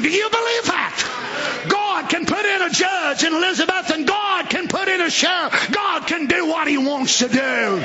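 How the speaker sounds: high, drawn-out hellfire preaching; preacher's high, ringing cry; fiery preaching. Acted. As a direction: thunder a warning at sinners. Preaches at full force.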